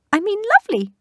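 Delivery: high, loud squeaky correction; squeak. Acted. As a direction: quickly cover with a squeak. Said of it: "he added in a squeak".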